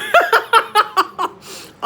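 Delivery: high, loud burst of laughter; big laugh. Real, not acted. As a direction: crack up loudly at your own failure. Laughing at failed bake.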